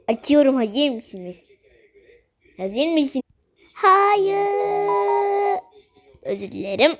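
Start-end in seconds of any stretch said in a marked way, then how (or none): 3.7-5.6 long high wailing no; high crying voice. Acted. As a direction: wail it like a crying doll. Doll character protesting.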